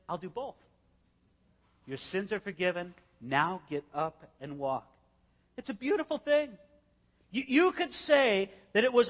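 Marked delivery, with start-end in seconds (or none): 0.1-4.9 quiet Jesus line. Retold or imitated. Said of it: "He said".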